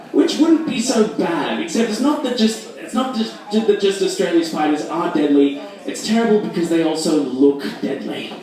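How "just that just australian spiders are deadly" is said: normal talking voice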